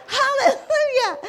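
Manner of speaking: high, loud, slow praise; giddy, hammed-up hallelujah; laughing hallelujah. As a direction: cry out praise through laughter. Praise after the whoop.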